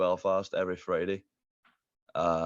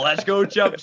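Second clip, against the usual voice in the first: excited quote; 11 semitones higher, 8 dB louder, slower than usual. Retold or imitated. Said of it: "I was all".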